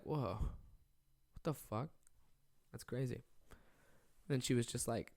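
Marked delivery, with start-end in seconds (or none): 0.0-3.2 soft, slow, stunned whoa; stunned murmur. Retold or imitated. Watching roommate spit on toothbrush, stunned soft murmur. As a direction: half-whisper it, frozen in disbelief.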